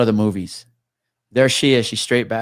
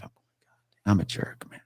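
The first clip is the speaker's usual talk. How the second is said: whisper; 3 semitones lower, 7 dB quieter than usual. Real, not acted. Self-reproach aside after retelling.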